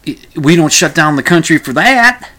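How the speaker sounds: Texan accent, lightly hammed up; Dr. Phil Texan accent. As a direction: impersonate a folksy Texan TV doctor. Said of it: "Sorry, I shouldn't do the accent".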